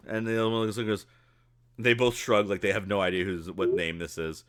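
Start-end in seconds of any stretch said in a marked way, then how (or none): none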